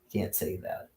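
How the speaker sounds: under-the-breath echo; whispered repeat. Retold or imitated. Echoing Michael's warning to himself in a whisper. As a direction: whisper it to yourself.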